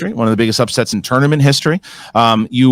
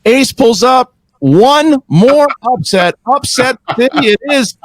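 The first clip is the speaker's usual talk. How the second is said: slow, higher ring-announcer call; announcer hype; 7 semitones higher, slower than usual. Acted. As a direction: build it up like a fight announcer.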